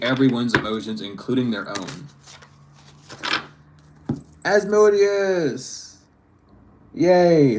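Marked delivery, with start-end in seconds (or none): 4.4-7.5 drawn-out, raised sing-song announcement, then a yay; mock cheer. Reader announcing the card to viewers, mock-excited cheer.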